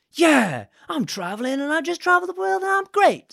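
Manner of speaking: high, cocky, self-mocking swagger; mock bravado. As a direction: play the overconfident traveller. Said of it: "I then end up being like".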